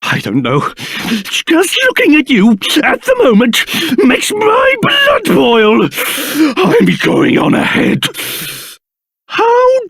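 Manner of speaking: spluttering, affronted outrage with shivery stutters; livid. Acted. King scolding a boy for insulting him, livid and spluttering. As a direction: splutter with pompous indignation. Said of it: "Livid, I tell you".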